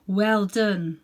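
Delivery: loud, slow regal praise; a touch of a booming lion; earth-shaking lion voice. Acted. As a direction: let it boom like a great lion. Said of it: "in a voice that made the earth shake".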